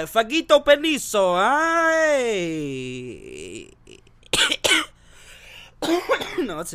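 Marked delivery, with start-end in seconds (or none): 0.0-3.7 hammed-up Italian accent, higher and louder; mock Italian greeting, overdone; bad Italian accent gag. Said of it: "bad Italian fucking accent jokes".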